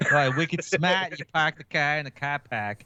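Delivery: dropped R's, slow mock Boston drawl on wicked smart; lightly put-on Boston, R's dropped; a hint of a Boston local; mock Boston accent. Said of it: "full Boston accent".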